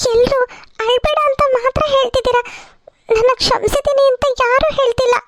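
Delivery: crying. Acted. Daughter-in-law telling the family nobody has forgiven her, tearful.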